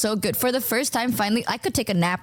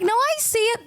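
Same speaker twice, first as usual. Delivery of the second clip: pitched way up, bluffing; high fake agreement; 12 semitones higher than usual. Bluffing to Numi about the red flag, high and quick. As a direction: insist brightly, way up high.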